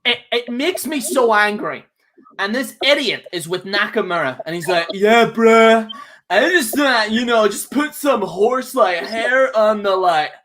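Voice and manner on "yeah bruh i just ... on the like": lightly voiced lazy stoner; mock stoner. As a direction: drag it out like a half-asleep stoner. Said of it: "like, a stoner thing".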